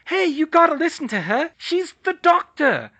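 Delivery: put-on high, loud, insistent girl voice; eager child shout. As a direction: urge the class to listen. Karen shouting at classmates.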